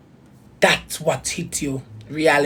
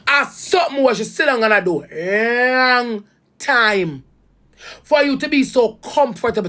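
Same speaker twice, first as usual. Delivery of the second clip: emotional; 5 semitones higher than usual. Real, not acted. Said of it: "I get so emotional".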